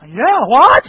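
loud, swooping question; blaring, baffled demand to repeat; exasperated can't-hear-you. Acted. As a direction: shout back at a mumbler. Acted listener who still cannot hear.

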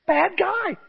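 high, squealed warning; hammed-up squeaky alarm; incredulous protest. Preacher likening Saul to TV villains, high mock alarm. Ananias voice repeated as punchline.

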